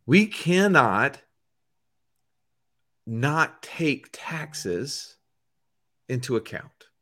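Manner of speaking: very slow, with long pauses between phrases; emphatic quote. Retold or imitated. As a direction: spell it out deliberately, phrase by phrase. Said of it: "says to us".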